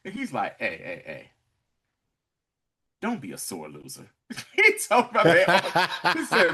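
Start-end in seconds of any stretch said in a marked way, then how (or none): 0.6-4.1 low, soft imitation of a calm man; understated, hushed warning; quiet placating. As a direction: talk him down, softly and low. Retold calm plea to gunman.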